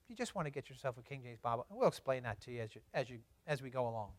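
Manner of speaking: soft, low, quick reassurance; kindly. Retold or imitated. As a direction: imitate a kind pastor gently advising. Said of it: "very kindly".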